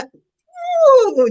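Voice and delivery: high, loud exasperated ooh; mad ooh. Real, not acted. Worked up talking to Rob, a high angry ooh. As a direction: let the anger burst out high. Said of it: "you got me all mad".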